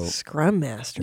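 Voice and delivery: slow low mock gravity; slow, low, mock-solemn. Real, not acted. Repeating job title.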